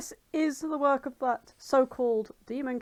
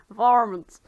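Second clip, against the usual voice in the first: Texan drawl; 9 dB louder than usual. Acted. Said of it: "got Texan very quick there".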